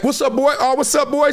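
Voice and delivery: high, hyped greeting to friends; calling out greetings. Retold or imitated. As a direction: shout hello up the bleachers. Greeting crowd in stands.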